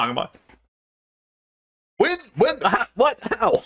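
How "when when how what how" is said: much higher, stammering disbelief; flustered surprise. Retold or imitated. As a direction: sputter in comic confusion. Stunned reaction retold.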